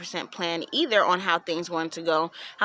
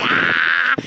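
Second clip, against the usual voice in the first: loud cry of being caught out; loud exasperated ah; 4 semitones higher, 7 dB louder than usual. Retold or imitated. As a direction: blurt a loud panicked ah. Cornered by mom.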